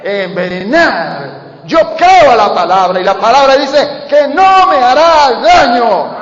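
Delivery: loud, drawn-out declaration of faith; fiery preaching. Acted. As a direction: proclaim it boldly to the church. Preaching climax. (proclamación de fe a gritos)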